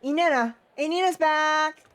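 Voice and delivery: high, loud happy shout of her name; joyful calling out. Acted. Dancers cry out to Irina, overjoyed and loud. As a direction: call across the hall, thrilled.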